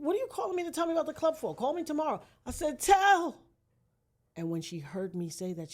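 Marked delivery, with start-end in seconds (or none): 0.0-2.2 impatient sister. Retold or imitated. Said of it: "She's like". 2.8-3.4 desperate call. Retold or imitated. Wounded, calling sister's name.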